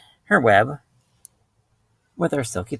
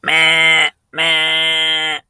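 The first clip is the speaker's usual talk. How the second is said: loud, held goat bleats; a goat's insistent meh; bleating goat; 3 semitones higher, 6 dB louder than usual. Acted. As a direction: bleat like a goat on one note. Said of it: "asked the goat".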